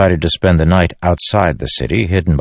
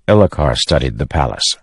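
ordinary delivery, nothing marked

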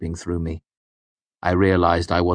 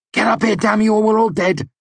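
furious shout; 5 dB louder than usual. A narrator's reading. Yelling at friend amid battle.

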